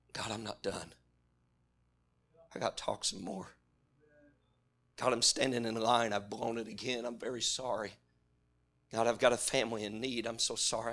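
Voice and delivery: very soft, pleading prayer under the breath; a quiet desperate plea to God; hushed earnest prayer. Retold or imitated. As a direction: pray low and urgent, almost to yourself. Quiet earnest prayer voiced as example.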